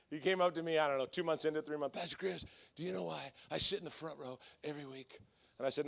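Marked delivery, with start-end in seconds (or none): 2.0-5.2 low, hushed mobster voice; Glenn. Retold or imitated. Intimidating usher confiding in his pastor, soft low menace. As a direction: imitate a quietly menacing confidant. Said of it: "he talks like this".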